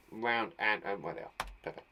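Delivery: ordinary delivery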